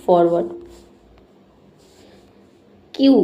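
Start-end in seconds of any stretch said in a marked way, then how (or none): 0.0-0.5 फॉरवर्ड said as "forward", not "four word"; correct pronunciation. Shown as a demonstration. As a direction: model फॉरवर्ड cleanly as "forward". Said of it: "लेकिन इसकी pronunciation होगी".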